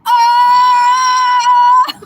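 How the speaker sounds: long, high, loud scream; imitated scream. Storyteller acting out the woman seeing people in the valley, screaming. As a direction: hold a high mock scream. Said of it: "She starts screaming".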